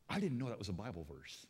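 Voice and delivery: ordinary talk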